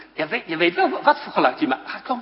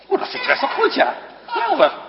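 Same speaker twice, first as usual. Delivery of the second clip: bright-eyed enthusiastic praise; excited praise; 12 semitones higher, faster than usual. Real, not acted. Praising child's rooster call.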